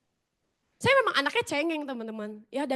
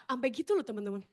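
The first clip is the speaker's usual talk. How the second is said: ordinary talk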